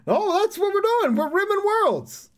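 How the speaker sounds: high, loud mock proclamation; mock-heroic shout. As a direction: belt it out in a high, drawn-out voice. Mock proclamation of the game's pun.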